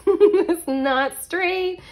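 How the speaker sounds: high, slow laughing squeal; helpless laughing complaint, loud and drawn out; laughing exasperation. Real, not acted. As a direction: wail it through giggles. Giggling at crooked card.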